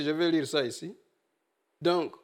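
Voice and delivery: ordinary talk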